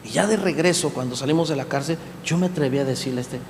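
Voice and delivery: ordinary talk, nothing marked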